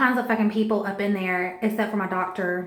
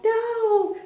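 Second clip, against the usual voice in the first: high, drawn-out cry of no; wailed refusal; 14 semitones higher than usual. Retold or imitated. Laboring mother told she'll be cut, wailed refusal. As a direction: plead it in a high wail.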